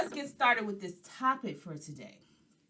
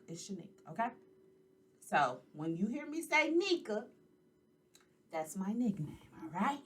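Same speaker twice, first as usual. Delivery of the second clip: normal talking voice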